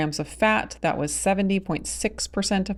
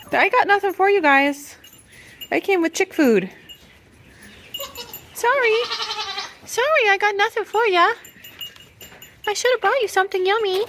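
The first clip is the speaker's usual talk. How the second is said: much higher sing-song pet voice. Real, not acted. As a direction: coo apologies to the goats like to babies. Talking to goats, no food.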